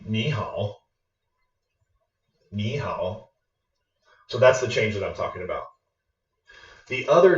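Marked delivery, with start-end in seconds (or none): 0.1-0.8 NI HAO run together, NI rising, HAO dipping; smooth tone change. Shown as a demonstration. Teacher demonstrating the smoother greeting, second tone then third. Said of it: "It's much more smooth". 2.5-3.3 softly, NI rising into a dipping HAO; quiet "Ni hao", second tone then third; smooth tone change. Shown as a demonstration. Teacher repeating the greeting for learners, softly with changed tone. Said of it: "change the first third tone to a second tone".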